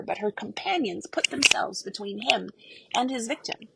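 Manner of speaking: usual speaking voice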